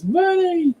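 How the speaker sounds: put-on very high squeal of a little girl. Little girl spotting the bunny, high excited squeal. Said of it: "she turns around and goes".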